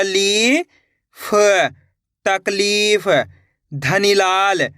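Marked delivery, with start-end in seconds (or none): none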